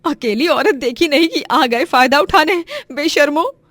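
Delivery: loud, high, indignant telling-off; furious scolding. Acted. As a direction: berate the shameless attackers. Woman scolds fleeing attackers. (गुस्से भरी ज़ोरदार फटकार)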